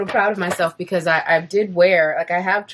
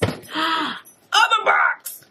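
surprised gasp; 9 semitones higher, much slower than usual. Acted. Gasps at the next box.

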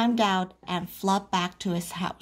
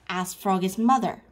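ordinary delivery, nothing marked

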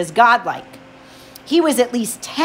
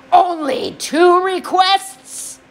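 menacing god voice; 9 semitones higher, slower than usual. Acted. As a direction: sneer it like an amused tyrant. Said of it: "Hades said".

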